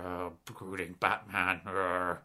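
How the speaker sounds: low, slow, gloomy growl; brooding Batman. Retold or imitated. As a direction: play a grim, glowering hero. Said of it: "the Batman versus Superman trailers were super grim".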